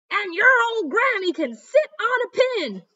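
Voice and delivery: high, loud taunting yell; pig yelling insult. A narrator's reading. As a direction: insult the granny with a shout. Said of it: "Then the third little pig yelled".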